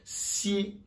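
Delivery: Si said like Mandarin xi, high; Si as the Chinese x; Chinese x sound. Shown as a demonstration. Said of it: "Le X et le S, il y a une différence".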